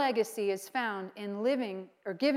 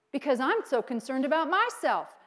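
usual speaking voice

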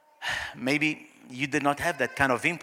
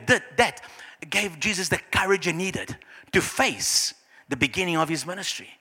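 normal talking voice

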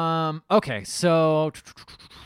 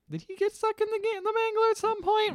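much higher incredulous voice; mock surprise. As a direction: squeak out the question. Wondering aloud at a detail.

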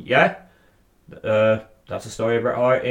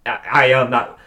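ordinary talk

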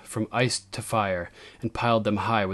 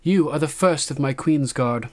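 queen's formal appointment; 6 semitones higher, 5 dB louder than usual. A narrator's reading. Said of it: "kissed the great knight gently and said".

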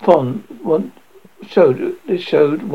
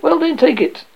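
higher taunt; 12 semitones higher than usual. Acted. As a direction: pitch pushed much higher. Taunting exchange repeated.

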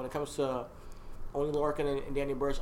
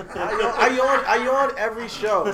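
laughing; 8 semitones higher, 11 dB louder than usual. Real, not acted. Laughing off the yawn teasing.

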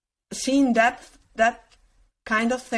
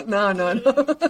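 laughing. Real, not acted. Laughing denial.

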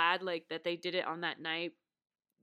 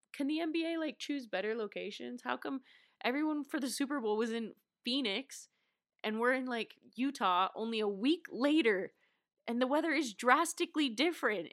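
exasperated rant; 5 semitones higher, 4 dB louder than usual. Real, not acted. Complaining about freezing weather.

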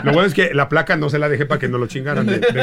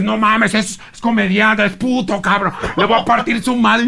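angry guests; 8 semitones higher than usual. Retold or imitated. Drunk guests threatening the comedian in drag, angry. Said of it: "ya llegaron los güeyes".

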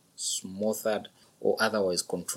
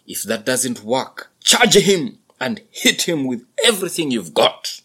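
buffalo father instructing; 4 semitones higher, 9 dB louder than usual. A narrator's reading. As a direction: lay out the battle plan loudly. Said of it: "father instructs son".

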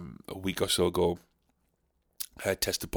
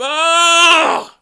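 high wounded shout; anguished wail; 12 semitones higher, 7 dB louder than usual. Acted. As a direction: wail in comic outrage. Old Dick Lips outburst.

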